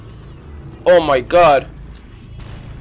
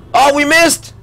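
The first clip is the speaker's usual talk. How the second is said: ordinary delivery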